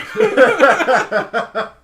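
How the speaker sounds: a big high burst of laughter; real laughter at the story; high loud laugh. Real, not acted. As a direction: crack up loudly.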